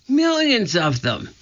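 high, alarmed exclamation; alarmed cry. A narrator's reading. Jack tells Annie how many ants are coming, crying out. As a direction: cry it out high without shouting. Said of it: "cried Jack".

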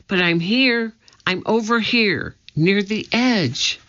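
ordinary delivery

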